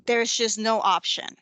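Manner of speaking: ordinary talk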